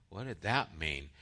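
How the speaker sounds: low, soft baffled question; confused disciple, quiet and lost; baffled mumble. Retold or imitated. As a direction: mumble it like a blank-eyed disciple. Disciples' blank stare, quoted.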